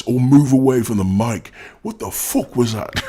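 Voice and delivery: loud, high-pitched rant; rising frustrated outburst; upset. Real, not acted. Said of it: "Why am I so upset".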